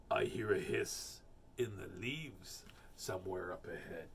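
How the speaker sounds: low, hushed monster voice; a wary beast listening; Gruffalo voice. Acted. Gruffalo hearing a hiss ahead, low wary voice.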